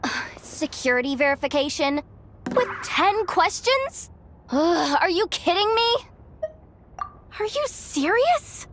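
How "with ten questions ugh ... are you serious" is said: exasperated disbelief. Acted. Mechanic balking at ten security questions, exasperated disbelief. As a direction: groan and protest, pitch climbing.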